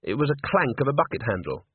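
ordinary delivery, nothing marked

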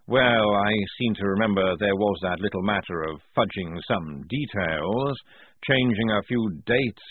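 plain reading voice

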